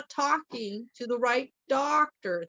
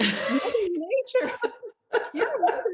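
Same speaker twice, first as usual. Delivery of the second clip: laughing; 11 semitones higher than usual. Real, not acted. Laughing while naming Mother Nature.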